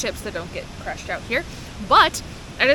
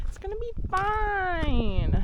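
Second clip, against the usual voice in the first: self-reassurance; 8 semitones higher, slower than usual. Real, not acted. Repeating reassurance to herself.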